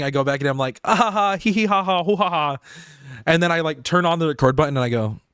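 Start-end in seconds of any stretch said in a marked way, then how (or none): 0.8-2.6 high, sing-song fake laughing; mock giddy laughter. Creator once he's done recording, mock giddy laughter. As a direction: babble out silly pretend giggles. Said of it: "second I'm done recording".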